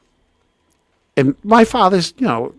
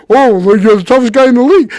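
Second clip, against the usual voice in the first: high, loud mimicked taunt; mocking mimicry; 10 semitones higher, 7 dB louder than usual. Quoting the kid's taunt to his girlfriend, mocking. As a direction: mimic the challenger in an outraged voice.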